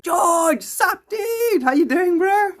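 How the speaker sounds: high, loud and bubbly; hyped loud hello; excited greeting. Real, not acted. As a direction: greet the viewer like a hyped friend. Greeting a viewer loudly.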